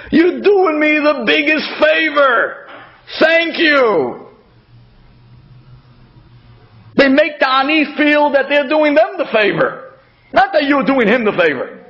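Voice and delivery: high, slow, beaming with a laugh; gracious thanks. Retold or imitated. Giver thanks the poor man as if owed, bright and laughing. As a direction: gush thanks as if they did you a favor.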